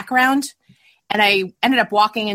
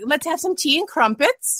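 higher-pitched, lightly put-on British accent; mock British accent; 6 semitones higher than usual. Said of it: "British, you know, British stuff".

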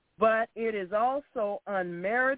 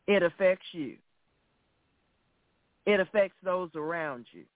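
ordinary talk, nothing marked

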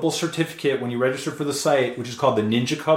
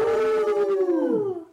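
long high wolf howl. Pretend. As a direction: throw your head back and howl like a wolf. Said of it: "we're gonna give a wolf howl for you".

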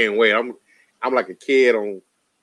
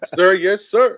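soldier reply; 6 semitones higher, much slower than usual. Pretend. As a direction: bark it like a cadet, grinning. Mock military reply to orders.

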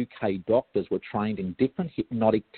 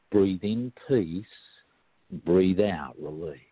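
slow mantra; 2 semitones lower, slower than usual. Shown as a demonstration. A hypnotherapist recites his favourite mantra, slow and calm. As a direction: breathe the words out slowly like a meditation guide. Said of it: "One of my favourite mantras is".

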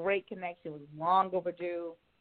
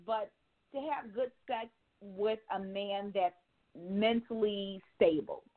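ordinary delivery

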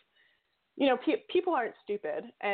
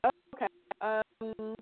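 cutting out on the call; choppy phone audio; bad connection; 2 semitones higher than usual. Real, not acted. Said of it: "you're breaking up".

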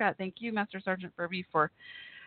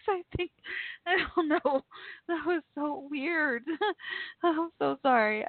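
breathless, shaky laughter; 9 semitones higher than usual. Real, not acted. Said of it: "I gotta catch my breath".

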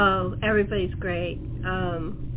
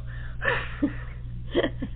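a high breathy giggle, held back; 12 semitones higher, 5 dB quieter than usual. Real, not acted. Host giggling as she teases about the hidden character. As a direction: giggle at the secret.

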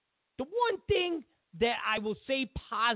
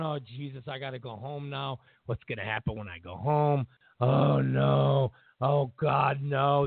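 mock dread; 7 semitones lower than usual. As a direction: play a caught cheating husband, lightly hammed. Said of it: "That dread".

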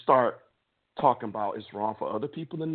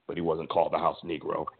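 ordinary delivery

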